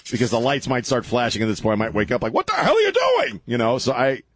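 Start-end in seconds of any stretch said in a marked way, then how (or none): 2.3-3.4 high startled yell; startled shout. Retold or imitated. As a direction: jolt awake and shout it. Waking up startled at wife.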